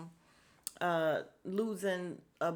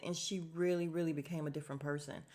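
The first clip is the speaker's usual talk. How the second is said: normal talking voice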